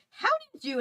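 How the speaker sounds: very high, loud mock outcry; imitated high-pitched gasp of a question; high-pitched exclamation. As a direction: squeak it out in mock shock. Re-enacting high sung or shocked line.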